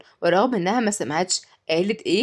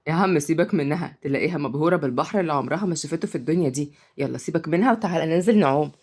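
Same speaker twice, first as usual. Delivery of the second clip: usual speaking voice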